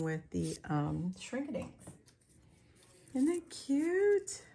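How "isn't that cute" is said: high, stretched-out cutesy question; cooing adoration. Real, not acted. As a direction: coo it slowly, melting over it. Cooing over a die cut.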